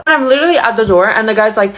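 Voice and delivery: usual speaking voice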